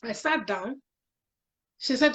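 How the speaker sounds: ordinary talk, nothing marked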